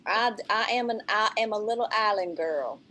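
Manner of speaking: ordinary talk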